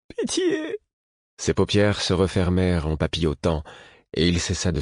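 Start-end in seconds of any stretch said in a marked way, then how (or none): 0.1-0.8 much higher, faint desperate plea; pleading. A narrator's reading. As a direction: beg in a high thin voice. Fool begging in delirium.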